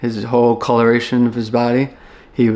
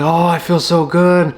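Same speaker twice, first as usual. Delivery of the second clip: imitating sister's happy relief; mildly excited, lifted on the opening; 3 semitones higher than usual. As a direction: retell her gushing with a touch of joy. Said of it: "She's excited".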